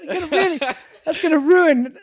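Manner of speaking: very high, squeezed voice through laughter; laughing mock dismay. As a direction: tell it helpless with laughter, pitch soaring. Hammed-up dismay at his entrance.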